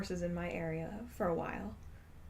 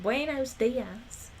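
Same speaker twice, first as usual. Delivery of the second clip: slow, stretched Texan twang on Spanish; drawled Southern gringo Spanish; Southern-accented Spanish; 3 semitones higher, much slower than usual. Retold or imitated. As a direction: imitate a Southerner greeting in drawn-out Spanish. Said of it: "the people with accents when they try to speak Spanish".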